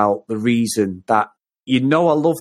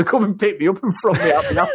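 laughing; 7 semitones higher than usual. Real, not acted. Laughing while retelling fear.

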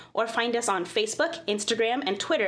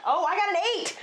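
excited; 9 semitones higher than usual. Real, not acted. Excited dice roll result.

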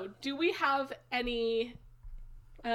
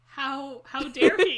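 mock outrage; 2 semitones higher, 8 dB louder, slower than usual. Mock outrage at beer drinking.

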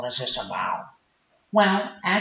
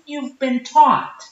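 normal talking voice

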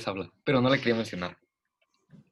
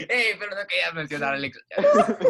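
higher mock-reproachful quote, laughing; imitating co-hosts; 4 semitones higher, 3 dB louder than usual. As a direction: mimic friends teasing you, through laughter. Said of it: "ustedes me dijeron". (imitando a los amigos entre risas)

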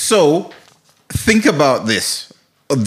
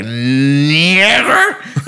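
long drawn-out comic imitation, as if echoing; exaggerated, slowly gliding skit voice; Chappelle. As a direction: stretch the word out as if through reverb. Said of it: "with the reverb added to the audio".

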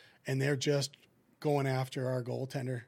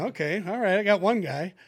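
pleased relief; 5 semitones higher, 7 dB louder than usual. Retold or imitated. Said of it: "And I'm going".

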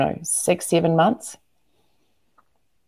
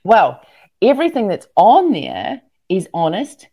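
ordinary talk, nothing marked

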